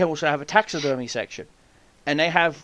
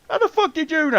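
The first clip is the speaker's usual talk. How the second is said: shocked question; 6 dB louder than usual. Retold or imitated. Retold son shocked at dad.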